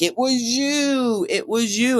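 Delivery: high, slow accusation, dragging out each you; accusing. Retold or imitated. Brother cornered on the rooftop, slow high accusation. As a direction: point the finger slowly from high up.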